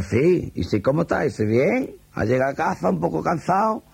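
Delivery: high-pitched and chatty, innocently matter-of-fact; a woman breezily reporting a normal evening; orderly's wife. Acted. Orderly's wife answering the captain on the phone, high-pitched and innocent.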